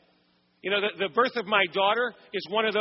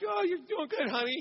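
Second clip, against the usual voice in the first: fake reassurance; 7 semitones higher, 5 dB quieter than usual. Husband fakes calm for wife.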